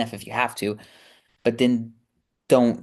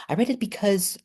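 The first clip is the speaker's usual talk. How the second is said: high-pitched attempt at an English accent; 9 semitones higher than usual. Shown as a demonstration. Vlogger reading a review as best she can, English accent. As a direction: put on an amateur English accent. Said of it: "in an English accent".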